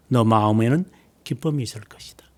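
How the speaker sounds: breathy hushed promise; whispered verse of comfort. Real, not acted. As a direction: let the blessing fall to a whisper.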